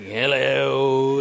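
loud, awkward mock hello; embarrassed dad's greeting. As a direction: greet strangers as a mortified father. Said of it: "I'm like".

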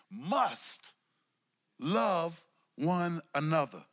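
"must" hit and held, then "love one another" slow; stress on must. Shown as a demonstration. Preacher stressing the command: "must" punched slow. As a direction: lean hard on "must". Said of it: "Emphatic in his tense".

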